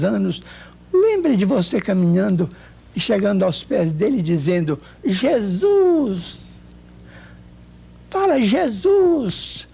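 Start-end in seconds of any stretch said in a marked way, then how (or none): 5.0-9.7 higher, very slow and drawn-out coaxing. Retold or imitated. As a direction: draw the words out so she can follow. Coaxing her to say Jesus.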